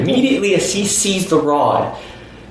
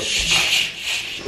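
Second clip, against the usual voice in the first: long, hushed "shh"; drawn-out voiceless rustle effect; imitated rustling; 5 dB quieter, much slower than usual. Noise heard again from under the car, imitated rustling. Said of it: "It doesn't sound like something small".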